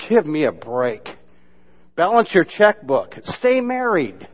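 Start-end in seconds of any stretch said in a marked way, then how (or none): 3.2-4.3 high, drawn-out, pointed; slow pointed punchline. Real, not acted. Preacher telling the congregation to stay married, drawn out. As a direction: land it slowly and pointedly. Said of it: "punchline delivered slowly".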